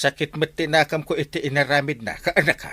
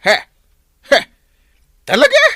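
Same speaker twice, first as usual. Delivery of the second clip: high, loud "really?!"; shocked disbelief; 9 dB louder, faster than usual. Acted. Friend hearing the gossip, a shocked high cry. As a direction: blurt it out in disbelief.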